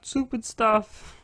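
high, slow whiny tone; kid version voice. Retold or imitated. Mimicking the young Chris character, high and slow. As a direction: whine it like a little kid. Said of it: "version's like".

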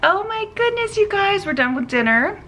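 ordinary talk, nothing marked

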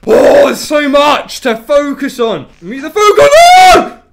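high, loud frantic wail; overwhelmed cry. Real, not acted. Gamer overwhelmed by the night, frantic high cry.

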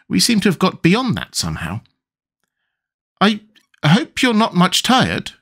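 put-on a polite little girl saying sorry; lightly voiced young Alice; Alice's voice. Alice speaks.

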